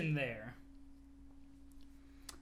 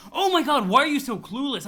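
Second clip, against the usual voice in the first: loud, higher, fed-up cry; loud exasperation; exasperated outburst; 4 semitones higher, 7 dB louder than usual. Acted. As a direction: throw up your hands at him. Jane exasperated at Jake.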